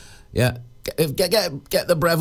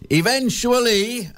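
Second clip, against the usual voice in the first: Manuel impression; 9 semitones higher, slower than usual. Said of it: "as Manuel used to".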